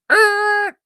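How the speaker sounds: high, loud imitated screech of tires; mouth-made squealing brakes; tire screech noise. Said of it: "I hear the tires screech".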